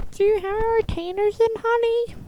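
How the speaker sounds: high, sweet nagging mom voice. Retold or imitated. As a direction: mimic a doting mother. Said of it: "Every night she's like".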